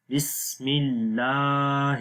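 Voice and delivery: slow بسم اللہ ending in a light 'hir'; thin raa. Shown as a demonstration. Teacher modelling for students, slow بسم اللہ with light 'hir'. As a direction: stretch 'laa' in اللہ, keep the final 'hir' thin. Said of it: "ہر باریک ہوگی آواز".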